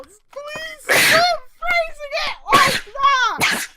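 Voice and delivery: anguished laughing cry; exasperated shout. Real, not acted. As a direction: beg the author to stop, half laughing. Laughing pleading at the writing.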